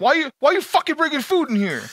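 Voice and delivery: high-pitched, outraged staff imitation; mock angry. Club staff getting mad at a prank caller, mock angry. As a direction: squawk the complaint in mock outrage. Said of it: "they get all mad".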